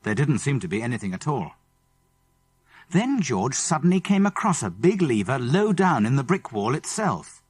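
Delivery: ordinary delivery, nothing marked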